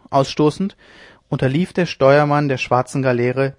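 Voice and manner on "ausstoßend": usual speaking voice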